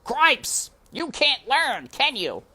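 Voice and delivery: very high, loud exasperated scolding; high, loud frustrated mouse guard. A narrator's reading. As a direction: stamp your paw and scold him. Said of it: "stamped a paw on the floor in frustration".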